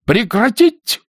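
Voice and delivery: high, loud command to stop; shouted order. A narrator's reading. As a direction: cry out to halt him. Nina yanks soldier, orders stop. (резкий окрик)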